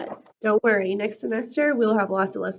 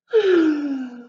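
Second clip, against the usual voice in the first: sigh; 4 semitones higher, 7 dB louder than usual. Acted. Cookie worrying at night.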